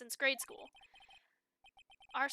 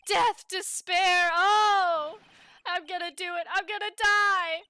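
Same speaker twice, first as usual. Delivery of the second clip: loud anguished wails; dramatic wailing; 5 semitones higher, 11 dB louder than usual. Acted. Larry wailing about dying.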